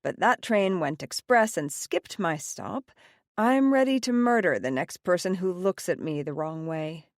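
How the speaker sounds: usual speaking voice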